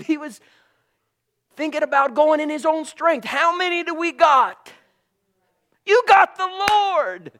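high, loud fervent declaration; preaching at full force. Acted. Preacher rallying the congregation, loud and high. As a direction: proclaim it like a revival shout.

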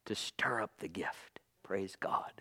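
breathy hushed whisper. Real, not acted. Preacher hushing the congregation, reverent whisper. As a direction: drop to a whisper of awe.

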